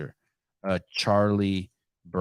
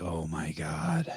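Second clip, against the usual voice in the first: slow, low, hushed mock dread; quiet dread; 4 semitones lower, 10 dB quieter, much slower than usual. Parent hearing the kid's scream coming, retold quiet dismay. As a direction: draw it out softly, sinking.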